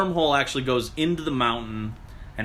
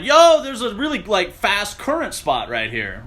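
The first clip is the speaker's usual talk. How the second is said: high, loud warning shout at the falls; excited call out; 8 semitones higher, 6 dB louder, slower than usual. Retold or imitated. Friends shouting a warning about the current. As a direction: yell it out to the others.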